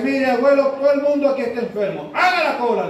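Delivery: mock-stern hospital scolding; scolding nurse. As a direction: play an exasperated nurse ordering someone back in line. Acted nurse scolds old man.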